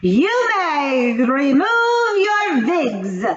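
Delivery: high, loud, drawn-out snarl; snarling witch. A narrator's reading. Grand High Witch orders the wigs off, slow high snarl. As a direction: snarl the order slowly and menacingly. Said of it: "snarled the Grand High Witch".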